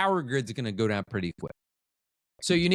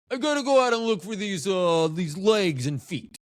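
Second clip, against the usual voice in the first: reluctant cop; 5 semitones higher than usual. Pretend. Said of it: "how do you get assigned to that as a cop?".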